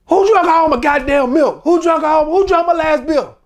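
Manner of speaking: fly off the handle over the missing milk; a stressed man comes home and snaps at his household, mock yell; mock angry yelling.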